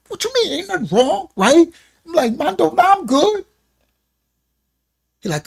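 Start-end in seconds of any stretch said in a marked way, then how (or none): 0.1-3.5 high, breezy brush-off; playing it off. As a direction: pretend nothing's wrong. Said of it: "I'm playing it".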